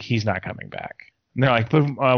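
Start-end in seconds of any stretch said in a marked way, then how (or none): 0.0-0.9 softer, lower knowing verdict; a low, softer, certain mutter; grim quiet certainty. Retold or imitated. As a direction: say it low and sure, like you already know. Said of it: "I was like".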